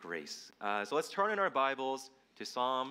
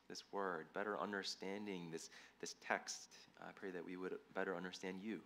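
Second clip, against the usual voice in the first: soft, low-key prayer with pauses; spoken prayer; 4 semitones lower, 7 dB quieter than usual. Real, not acted. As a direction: pray quietly on behalf of the congregation. Leads the congregation in prayer.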